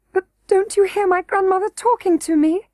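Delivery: on the edge of tears; almost crying. A narrator's reading. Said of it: "almost crying".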